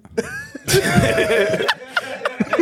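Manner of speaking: shrieking laugh, real; laughing hard at a joke; high laughing fit. Real, not acted. Loud high laugh at rice joke.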